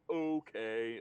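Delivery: mock dim-witted hobgoblin, loud drawn-out word; slow and stupid. Game master imitates how Orc used to talk, slow and stupid. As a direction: draw it out slowly, like a dimwit. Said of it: "he was talking very slowly and stupidly".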